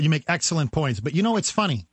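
ordinary talk

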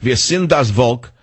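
German rallying call. Shown as a demonstration. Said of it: "the old rallying call".

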